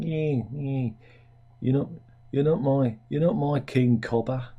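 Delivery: a hint of broad Aussie, mocking the senator; mock Australian accent. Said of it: "I imagine that's how she said it".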